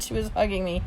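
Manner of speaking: usual speaking voice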